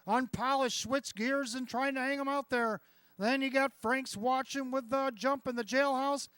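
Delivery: loud, high stretch call; excited race caller. Real, not acted. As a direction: build to a roaring finish. Stretch drive building.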